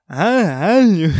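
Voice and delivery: slow, loud, strained croak of a swollen throat. Retold or imitated. Recalling the morning mirror shock, slow strained croak. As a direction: drag it out like your throat is blocked. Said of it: "Aí minha voz tava fanha".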